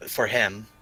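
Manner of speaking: usual speaking voice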